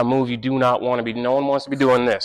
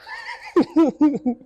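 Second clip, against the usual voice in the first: short laugh. Real, not acted. Laughing at the rustling.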